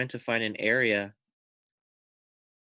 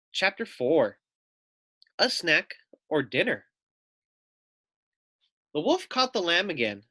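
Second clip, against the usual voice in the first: put-on lively, louder read-aloud voice for kids; storybook narration; 7 semitones higher, 3 dB louder than usual. A narrator's reading. Teacher reading a picture book to children, animated narration.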